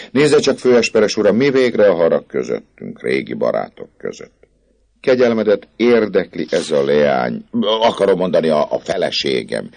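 a wheedling poet talking his way out; chatty Gringoire. A narrator's reading.

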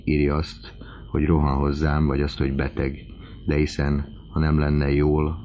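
ordinary delivery, nothing marked

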